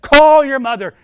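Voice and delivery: shouted command, pitched high and loud; ringing prophetic shout. Retold or imitated. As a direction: call him home with full force. Pastor repeating command louder.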